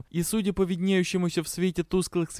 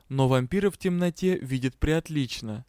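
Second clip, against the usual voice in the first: ordinary delivery, nothing marked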